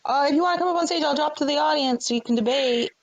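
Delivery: high, singsong welcome; mock friendly greeting. Host welcoming a rival onto the stage, mock friendly and high-pitched. Acting out own welcome to Darth.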